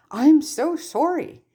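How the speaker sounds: high, slow, pained blurt of sorry; Harry blurting out a shocked apology. A narrator's reading. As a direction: let the apology burst out unplanned. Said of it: "Harry blurts".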